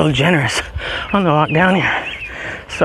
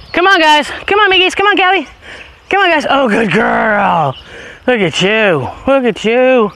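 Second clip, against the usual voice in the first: high dog calls; 7 semitones higher than usual. Real, not acted. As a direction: cheer them along. Calling and praising dogs.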